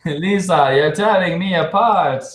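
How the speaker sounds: mock anguished Wiseau plea; Tommy Wiseau impression. Quoting the movie breakup line, hammed-up Wiseau voice. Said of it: "You sound like Tommy Wiseau".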